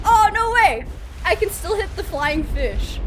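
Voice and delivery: high, loud delighted exclamation; real excitement. Real, not acted. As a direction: burst out, thrilled by the discovery. Excited discovery in game.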